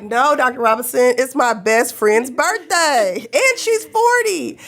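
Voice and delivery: high, slow, rising plea; pleading protest. Retold or imitated. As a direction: whine it out slowly, pleading. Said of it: "I'm like".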